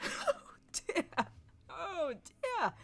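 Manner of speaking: breathy, high gasps of laughter; laughing. A narrator's reading. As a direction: wheeze it out between laughs. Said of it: "as he caught his breath before another outburst".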